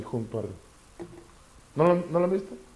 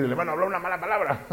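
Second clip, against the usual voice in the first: mock scandalized brother; 5 semitones higher, faster than usual. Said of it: "van a decir".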